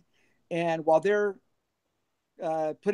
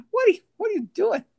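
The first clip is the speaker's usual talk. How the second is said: incredulous brother; 13 semitones higher, 6 dB louder, faster than usual. Retold or imitated. Brother reacting to his lyrics.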